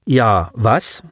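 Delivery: pointed, rising question; questioning prompt. A narrator's reading. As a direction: prod the other for an answer. Prompting the silent alien.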